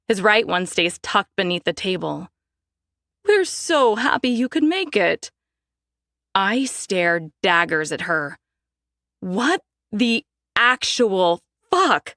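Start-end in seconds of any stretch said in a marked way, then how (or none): 3.2-5.3 gushing, sugary mother's voice; a hint of a simpering society mom; fawning mother. Mother fawning over Conrad. 9.2-12.1 slow, incredulous swear; stunned disbelief. Acted. Daphne stunned at dinner.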